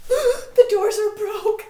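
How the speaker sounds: high, loud, slow cry of alarm; alarmed shout. Acted. Messenger to the court as the doors give, shouting. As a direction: yell that the doors have given way.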